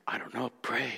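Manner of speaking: very soft, stunned reply; barely voiced, helpless whisper; hushed whisper. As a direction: whisper it, at a loss. Stunned reply over the dead dog.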